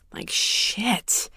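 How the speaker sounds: whispered shit under the breath; hushed resigned whisper; muttered shit. Real, not acted. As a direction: breathe out the curse to yourself. Muttered aside after bad news.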